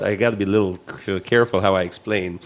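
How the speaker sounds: usual speaking voice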